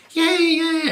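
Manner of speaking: put-on high excited kid; little kid cheer. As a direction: squeal it like a child.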